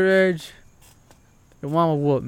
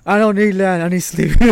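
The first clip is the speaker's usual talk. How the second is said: mock plea; 4 semitones higher, 8 dB louder than usual. Desperate exhausted parent.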